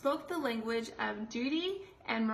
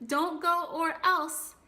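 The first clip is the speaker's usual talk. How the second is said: DON'T GO OR ELSE loud, high and slow, broken off after "else"; cut-off threat; 6 semitones higher, 6 dB louder, slower than usual. Shown as a demonstration. Example of a sudden pause, dramatic cut-off after "else". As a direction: stretch out "Don't go or else" and stop dead. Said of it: "else is where they pause and cut off".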